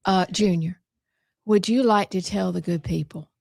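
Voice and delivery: ordinary talk, nothing marked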